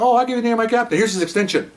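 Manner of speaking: sneering offer, retold with an edge; sarcastic comeback. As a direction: toss the captain's name back at him. Sarcastic retort in retold argument.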